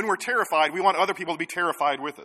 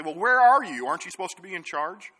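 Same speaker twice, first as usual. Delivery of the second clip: mock indignant demand, higher and loud at the start; indignant complaint; 5 semitones higher, 7 dB louder than usual. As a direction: throw the blame at God in feigned outrage. People suddenly blaming God.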